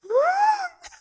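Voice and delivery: very high, sharp overwhelmed gasp; a gasp that sounds like a tiny shriek. Retold or imitated. Reliving the chapter for her co-host, one high gasp. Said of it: "And I'm like".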